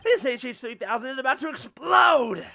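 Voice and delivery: loud frantic villain yell; shouting threat. Acted. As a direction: scream the bomb warning.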